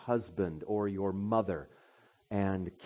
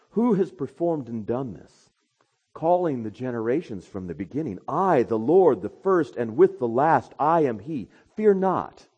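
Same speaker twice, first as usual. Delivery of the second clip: loud, higher preaching voice; full-force sermon delivery; 5 semitones higher, 9 dB louder than usual. Acted. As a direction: preach it out to the congregation.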